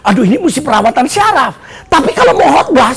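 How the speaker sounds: ordinary talk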